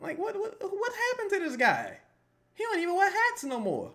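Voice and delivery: hammed-up fan asking what happened to him; mock shocked fan. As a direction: squeal like a scandalized fan. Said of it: "you be like".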